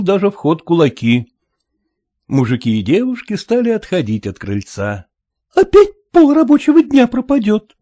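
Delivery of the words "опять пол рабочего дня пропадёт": put-on girl grumbling. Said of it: "бормотала черноглазая Аришка".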